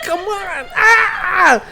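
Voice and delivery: high laughing shout; giddy shout. Retold or imitated. Egging on his friend, laughing and shouting high. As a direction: cheer it out laughing. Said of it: "I'm like".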